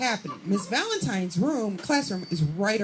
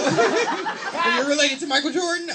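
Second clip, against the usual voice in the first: higher, louder mimicked reaction; mock incredulous; 8 semitones higher, 6 dB louder than usual. Locals reacting to her famous-dad claim, mock incredulous. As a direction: act out their disbelief loudly.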